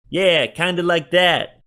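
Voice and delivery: put-on high, loud, whining city accent; whiny city shopkeeper. A narrator's reading. Joe describes the hooligans to Berkeley, whining. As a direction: whine it out like a put-upon shopkeeper. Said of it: "Joe whined in his thick city accent".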